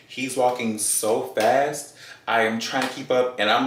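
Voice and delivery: ordinary talk, nothing marked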